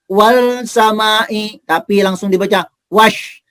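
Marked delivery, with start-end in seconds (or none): 0.1-1.6 very slow wal samai with a clear l; wrong reading. Shown as a demonstration. Teacher warning pupils off the wrong reading, slowly. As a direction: drag out wal samai, sounding the lam. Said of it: "Jangan dibaca".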